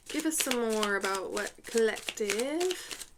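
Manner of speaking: put-on British accent with drawn-out words; playful British accent, slowing toward the end. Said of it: "doing a British accent".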